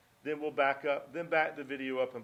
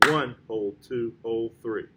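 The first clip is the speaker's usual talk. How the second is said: slow count, "One" stressed, "hold" stretched out; counting held notes; 5 semitones lower, 6 dB louder, slower than usual. Shown as a demonstration. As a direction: count slowly through the held beats. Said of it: "more emphasis on the accented notes".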